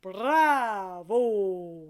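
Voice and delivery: loud, full bravo from the gut; big hearty bravo; bravo from the belly. Shown as a demonstration. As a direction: push it up from the belly. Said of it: "right in the belly".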